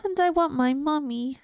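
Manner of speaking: a touch of whimper; tearful. Acted. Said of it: "he sniffed".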